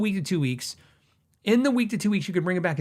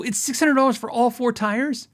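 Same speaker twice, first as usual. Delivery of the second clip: amazed; 8 semitones higher, 3 dB louder than usual. Retold or imitated. Amazed at cheap price.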